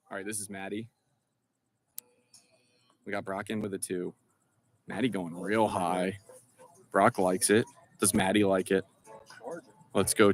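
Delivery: hushed golf commentary; low, soft TV golf announcer; golf commentator. Pretend. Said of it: "getting my Sports Center on here".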